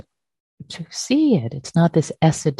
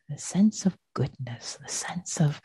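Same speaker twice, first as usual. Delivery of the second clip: soft whisper; 8 dB quieter than usual. Acted.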